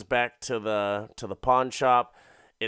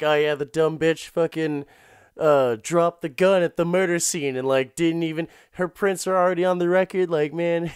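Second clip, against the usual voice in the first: higher, louder mocking cop voice; smug cop trash-talking, a bit fast; gossiping cop; 5 semitones higher, 5 dB louder than usual. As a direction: gloat about the dumb suspect.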